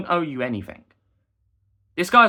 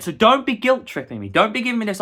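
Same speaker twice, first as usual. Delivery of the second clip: higher, louder telling-off; retold angry scolding; 8 semitones higher, 5 dB louder than usual. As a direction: warn him off sharply. Retold argument, heated quote.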